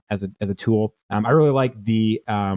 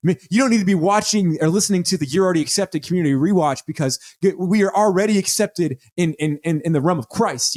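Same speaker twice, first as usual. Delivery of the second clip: higher, gushing preacher impression; pastor voice; 7 semitones higher than usual. Pastor preaching to his congregation, mock gushing and higher. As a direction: sell it like a modern evangelical pastor. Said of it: "what a pastor would say".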